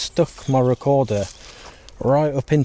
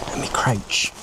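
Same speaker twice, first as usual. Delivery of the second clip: whispering; 4 dB quieter than usual. Real, not acted. Hiding in dark woods.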